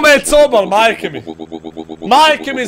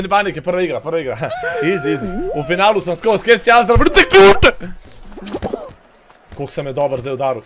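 ordinary delivery

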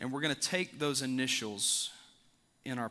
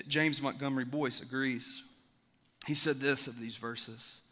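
usual speaking voice

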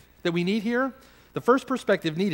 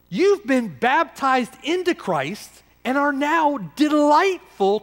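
preaching at full force; 4 semitones higher than usual. Real, not acted. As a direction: pour it out over the congregation, building.

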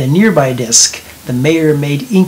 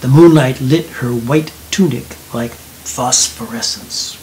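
ordinary delivery

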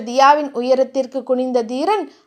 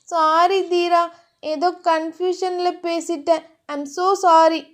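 higher, lightly voiced apologetic heroine; apologetic girlfriend; 3 semitones higher, slower than usual. Dhiya says sorry to her lover, apologetic.

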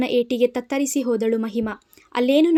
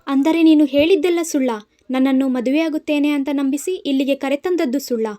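roaring accusation; 3 semitones higher, 4 dB louder than usual. Acted. Mahima to Kshit, realising the trick: roaring accusation. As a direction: bellow the accusation at him. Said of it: "ಎಂದಬ್ಬರಿಸಿದಳು".

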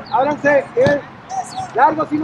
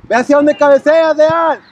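shouting; 3 semitones higher, 7 dB louder than usual. Real, not acted. Coach yelling from the sideline.

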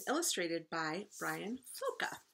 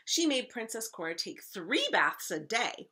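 loud, animated read-aloud; emphatic story narration; 2 semitones higher, 7 dB louder than usual. A narrator's reading. Teacher reading to first graders, emphatic story narration. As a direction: narrate with big stresses on key words.

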